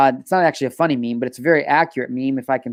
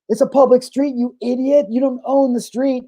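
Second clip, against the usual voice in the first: higher-pitched mock indignant yell; imitated angry heckling; 7 semitones higher than usual. Retelling a bystander from the video, angrily heckling. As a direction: act out an irate passer-by telling someone off.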